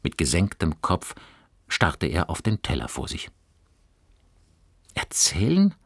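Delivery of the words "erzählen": puzzled. A narrator's reading. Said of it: "wiederholte Fabian verwundert".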